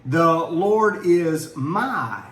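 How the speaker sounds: very slow, word by word, landing on "my"; counted out on fingers. Shown as a demonstration. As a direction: count "The Lord is my" slowly on the fingers. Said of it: "if I did it with this hand".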